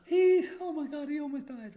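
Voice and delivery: high, loud mock gasp of horrified mothers; a hammed-up mother's gasp, oh my God. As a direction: squeal it like shocked mums gasping. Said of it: "All the mothers are just gasped".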